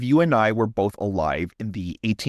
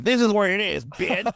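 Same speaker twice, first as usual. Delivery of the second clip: mock threat; 11 semitones higher than usual. Comic threat in the skit.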